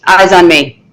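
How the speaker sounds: firm call for the class's attention; teacher command. Shown as a demonstration. Teacher calling a fifth grade class to attention. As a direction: claim the room with one line. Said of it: "I can walk into a room and say".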